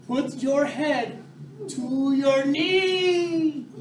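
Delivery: slow, stretched-out yoga command; very slow coaching call with long vowels; drawn-out instruction. Shown as a demonstration. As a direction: draw every word out for the class.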